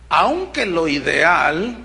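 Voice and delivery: usual speaking voice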